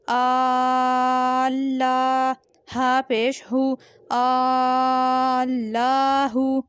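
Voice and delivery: आल्लाहू with the long आ held very slowly; long vowel stretch. Shown as a demonstration. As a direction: hold the खड़ा ज़बर in आल्लाहू. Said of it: "लाम खड़ा ज़बर ला". (आल्लाहू में आ को लंबा खींचें)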